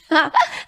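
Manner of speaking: bursts into a high real laugh. Real, not acted. Host laughs at the caller's hillbilly joke, real high laugh.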